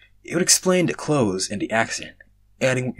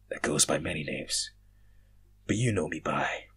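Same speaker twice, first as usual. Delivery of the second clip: low voice; 6 semitones lower, 6 dB quieter than usual. Acted. Mysterious voice answers.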